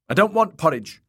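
high, loud tantrum; loud, high-pitched petulance; childish refusal. A narrator's reading. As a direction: refuse like a furious little boy. Boy refuses, then flings his spoon.

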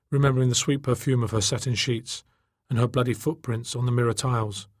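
ordinary delivery, nothing marked

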